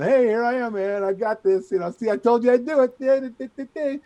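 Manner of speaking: loud, high, cocky trash talk; hammed-up gloating to a friend on camera. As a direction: taunt your buddy on video, full swagger. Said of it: "I'm gonna trash talk him".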